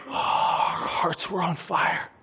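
slow, whispery sigh of wonder; awestruck gasping. Acted. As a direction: breathe it out, overcome with wonder. Acting disciples marvelling at Jesus' teaching.

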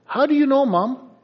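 put-on high, astonished kid's question; a kid caught out and baffled; amazed child. Child amazed mom knows.

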